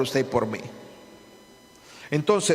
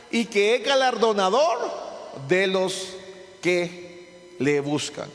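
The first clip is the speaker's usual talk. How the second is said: scripture read with force; 3 semitones higher than usual. Acted. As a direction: proclaim the verse, drawing it out. Reads Hebreos 11:6 with force.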